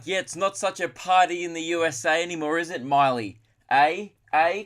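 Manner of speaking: bad Canadian accent. Canadians turning [name] away, in a bad Canadian accent. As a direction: ham up a Canadian accent, punching each eh. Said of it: "worst attempt at a Canadian accent".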